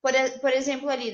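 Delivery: ordinary talk, nothing marked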